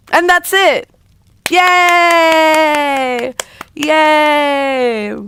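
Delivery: loud, high celebratory cheer; big, high-pitched hooray. Real, not acted. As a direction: celebrate with long drawn-out yays. Celebrating end of the story.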